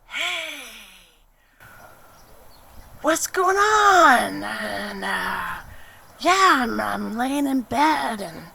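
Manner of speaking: high, loud, hammed-up sleepy friend on the phone; Gordon voice. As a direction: play him as a giddy overgrown kid just woken up. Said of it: "Gordon's like".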